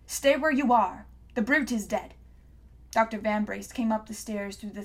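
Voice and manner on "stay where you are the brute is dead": stern guard. A narrator's reading. Guard repeats command.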